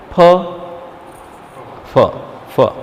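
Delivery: ph puffed out with a strong breath, three times; aspirated ph. Shown as a demonstration. Teacher drilling the class on the aspirated ph. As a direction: blow a hard burst of air on each ph. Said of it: "we have to pronounce it is like".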